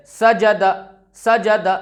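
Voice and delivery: सजद in three short syllables; crisp, quick सजद; short vowels, read quickly. Shown as a demonstration. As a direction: say सजद briskly without stretching. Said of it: "जल्दी पढ़ना है।".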